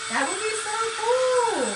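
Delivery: high, loud delight; thrilled at a crafting idea; excited. Real, not acted. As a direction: squeal with excitement over the idea.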